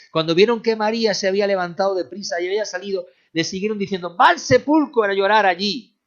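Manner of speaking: high, loud, driving sermon voice; full-force preaching. Acted.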